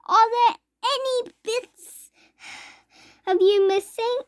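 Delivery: anxious mother voice; worried mother. A narrator's reading. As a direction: fuss over the child in alarm. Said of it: "cried Missus Teavee".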